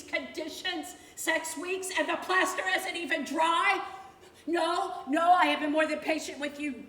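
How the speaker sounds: loud, biting scolding; an escalating, shouted rant at the contractor; furious tirade. Acted. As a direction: tear into him. Berating Deskey over delays.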